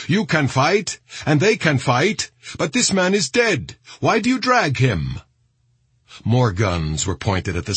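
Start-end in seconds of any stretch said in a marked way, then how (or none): 0.1-5.2 high, impassioned argument; persistent protest. A narrator's reading. Saudi soldier objecting to the body being dragged, insistent. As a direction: plead the case forcefully, pitch held up. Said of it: "the Saudi persisted".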